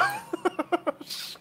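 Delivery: squeaky breathy giggle; high giggle. Real, not acted. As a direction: giggle helplessly. Laughing fit. (petit rire aigu)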